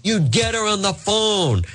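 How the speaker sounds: high mock shout at an aide; hammed-up scolding of a staffer; berating Eva in mock fury; mock angry yell. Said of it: "Yelling at Eva Mancuso".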